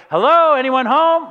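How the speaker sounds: teasing shout at a dull spouse; mock exasperated call. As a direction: call out as if nobody is in there. Bored spouse mocking level partner.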